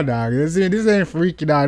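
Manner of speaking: normal talking voice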